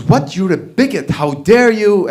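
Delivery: high, mock-outraged accusation; indignant. Wife scolding her husband for bigotry, indignant.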